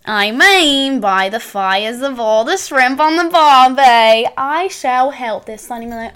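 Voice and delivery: slow, hammed-up Australian accent. Shown as a demonstration. Co-host performing for waiting friend, in a hammed-up Australian accent. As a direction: draw it out in broad mock-Australian. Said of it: "Do your Australian".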